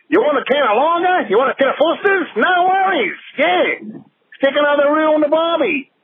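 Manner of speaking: high, hammed-up Aussie accent; Australian accent. Caller parodies an Australian offering beers, high hammed-up Australian accent. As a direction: pitch it up into a caricature Australian. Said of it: "That's actually Australian that became".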